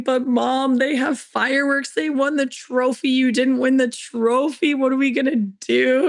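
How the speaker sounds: high-pitched mock sobbing like a six-year-old; imitating sobbing daughter. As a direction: play the heartbroken little girl crying that mom lost. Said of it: "just sobbing".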